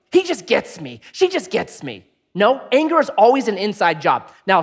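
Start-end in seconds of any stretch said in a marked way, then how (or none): 0.1-2.1 mock victim. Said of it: "never play the victim".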